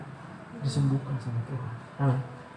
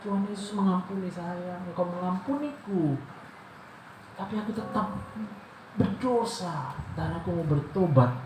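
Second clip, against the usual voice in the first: repentant prayer; 5 semitones higher than usual. Retold or imitated. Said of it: "kalau kau nangis".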